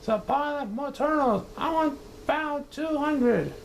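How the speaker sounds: high, pompous commands; bellowing orders. Acted. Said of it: "he bellowed and brayed".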